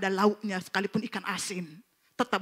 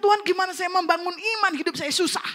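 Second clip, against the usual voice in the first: pleading; 9 semitones higher, 4 dB louder than usual. Retold or imitated. Retold tearful complaint to God.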